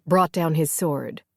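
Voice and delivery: ordinary delivery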